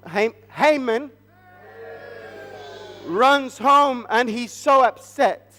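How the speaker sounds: ordinary delivery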